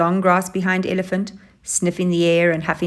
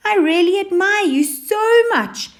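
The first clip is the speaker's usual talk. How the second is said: high, louder fawning whine; whining; 14 semitones higher, 5 dB louder than usual. Acted. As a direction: gush up at the lion, wheedling. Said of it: "she whined".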